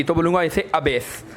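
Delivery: ordinary talk, nothing marked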